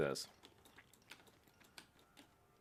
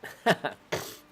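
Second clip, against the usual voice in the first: breathy laugh; 10 semitones higher, 8 dB louder than usual. Real, not acted. Laughs at own OCD.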